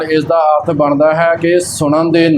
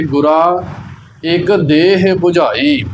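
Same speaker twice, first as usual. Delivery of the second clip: "ਗੁਰਾ", a clear pause, then slow "ਇੱਕ ਦੇਹ"; pause after ਗੁਰਾ; slower than usual. Shown as a demonstration. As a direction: stop after "ਗੁਰਾ" before reading on. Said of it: "ਗੁਰਾ ਤੇ ਵਿਸ਼ਰਾਮ ਲਾ ਕੇ". ("ਗੁਰਾ" ਤੇ ਵਿਸ਼ਰਾਮ)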